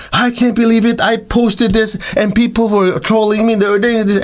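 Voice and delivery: high, indignant complaining; upset complaining. Retold or imitated. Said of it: "and they're getting all upset".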